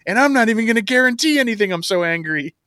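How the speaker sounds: high, hammed-up imitation of an angry boast; mock angry Trent. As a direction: pitch it way up like a cartoonish tough guy. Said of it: "And then Trent goes".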